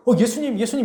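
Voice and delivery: high-pitched excited call to Jesus; mock cry for attention, pitched high; acted calling out. Blind beggar hears it is Jesus of Nazareth, high acted call. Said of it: "소리를 질러".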